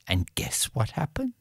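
hushed whisper to build suspense; conspiratorial storyteller whisper to the children; storyteller's whisper. A narrator's reading. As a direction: lean in and whisper it like a secret. Storyteller teasing the listeners.

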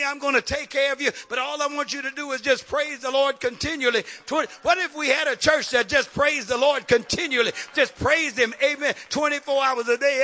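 impassioned church preacher, loud and insistent; fired-up preaching. Acted. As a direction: preach it at full force, driving each phrase home. Preaching at full force.

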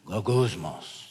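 "goguzmos" said low and soft, like a grumble; muttered Greek word. Shown as a demonstration. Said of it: "It sounds like its meaning".

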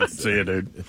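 laid-back surfer dude; casual surfer sign-off. Real, not acted. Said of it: "I sounded like a surfer right there".

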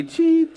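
high mock beeping; high mock scanner beep. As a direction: squeak like a barcode scanner.

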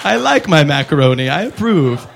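slow, solemn, half laughing; mock godly approval. Tasting macaroni like God, slow mock solemn. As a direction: judge the macaroni like God judging creation. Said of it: "I'm like".

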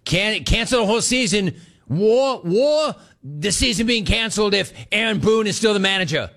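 high-pitched mock New York accent; exaggerated New York accent, high and worked up; Tony's New York accent. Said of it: "You could hear it in his voice".

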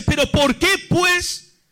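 high, insistent questioning in measured phrases; Dios. Retold or imitated. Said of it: "Dios se hace una pregunta y dice". (reproche divino, agudo e insistente)

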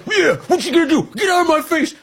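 mock the protester getting in troopers' faces; mock confrontational shout. Host mocking protester squaring up to troopers, shouting.

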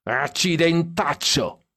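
put-on drunk shout. A narrator's reading. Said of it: "urlò Stan dietro".

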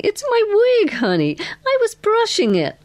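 high-pitched, swooping amused reply; amused, laughing. A narrator's reading. Girl explains the wig on her lap, laughing. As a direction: play her tickled, pitch leaping up. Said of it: "she said, laughing".

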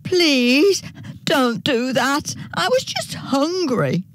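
high-pitched storybook begging; pleading dingo. A narrator's reading. Tied-up dingo begs not to be skinned, high pleading.

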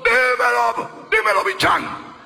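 playful Dominican street greeting, high and loud; Dominican accent. Retold or imitated. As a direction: tease like a Dominican friend. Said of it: "hablan como dominicanos".

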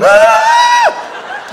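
very high loud shriek; terrified scream. Retold or imitated. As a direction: shriek running on water. Shooting out of the water.

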